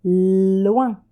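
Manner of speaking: "loin" with a long held L; drawn-out L. Shown as a demonstration. Teacher dictates the first syllable to a pupil, "loin" with lengthened L. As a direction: stretch the L at the start of "loin". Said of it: "La première syllabe".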